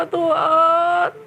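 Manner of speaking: high, slow weeping plea; tearful prayer. Acted. Kristian prays tearfully for forgiveness, high and slow. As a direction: beg God through tears. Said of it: "Nangis dia".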